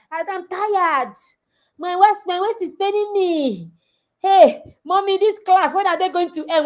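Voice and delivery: high whining complaint about her waist; whiny tired girl. Retold or imitated. Coach mimics a tired girl whining to her mommy. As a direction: play the tired girl begging mommy to stop class. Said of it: "Not by sitting down".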